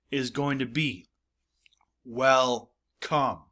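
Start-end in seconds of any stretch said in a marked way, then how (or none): 2.0-3.4 syllable break. Shown as a demonstration. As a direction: welcome with a clear gap, WEL... COME. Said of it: "is where that syllable break".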